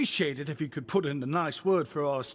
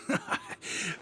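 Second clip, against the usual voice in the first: soft chuckle; 5 dB quieter than usual. Acted. Shopkeeper admits his motives.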